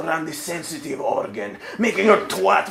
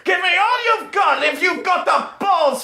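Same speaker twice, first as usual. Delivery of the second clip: much higher, hammed-up commanding lady; Integra demanding; 10 semitones higher than usual. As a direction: bark orders in a high mock-imperious voice.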